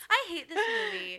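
high, loud put-on gripe; mock whining complaint. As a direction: imitate an entitled guest griping. Joins in mimicking the houseguest.

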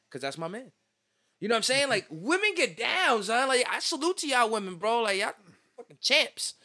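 ordinary talk, nothing marked

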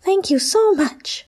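very high, slow, drawn-out cheer; exclaiming. Acted. The freed little Triceratops cheers its rescuers, very high and slow. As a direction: squeal with joy at being free.

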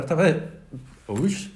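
choking mid-sentence; words catching in the throat. Real, not acted.

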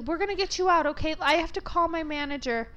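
put-on high, reassuring girl's voice; imitated salon employee. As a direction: pitch it up, helpful through a door. Voicing salon girl reassuring her.

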